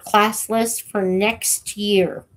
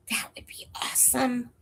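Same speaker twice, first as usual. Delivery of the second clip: whispered, softer hopeful aside; whispering; 4 semitones higher, 9 dB quieter, faster than usual. Real, not acted. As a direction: breathe it as a hushed hint. Asks viewers to share videos.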